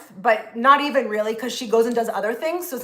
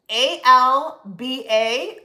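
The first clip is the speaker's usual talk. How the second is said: slow, spaced-out letters; spelling out; much slower than usual. Shown as a demonstration. Telling viewers the healer's first name, spelled out slowly.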